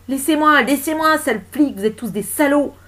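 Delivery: high, loud yell while struggling; furious protest. Acted. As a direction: shout abuse as you fight them off. Woman struggling with police.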